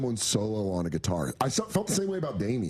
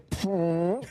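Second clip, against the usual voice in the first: imitated fart noise; 8 semitones higher than usual. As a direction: blow a raspberry like a fart. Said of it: "and let out a huge fart".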